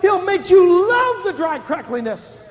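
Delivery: loud, high, slow preacher's cry; preaching at full force. Acted. Preacher rousing the congregation, loud and slow. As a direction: preach it out to the crowd.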